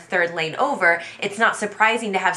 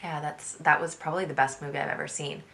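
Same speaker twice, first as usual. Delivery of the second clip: much softer, flat and quick; mock depressed; 3 semitones lower, 7 dB quieter than usual. Husband mumbling praise for the movie to the family, soft and flat. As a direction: praise it like you couldn't care less. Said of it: "you seem pretty depressed about it".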